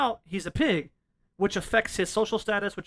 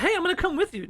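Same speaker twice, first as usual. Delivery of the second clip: put-on chipper girl; 9 semitones higher, faster than usual. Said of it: "She's like".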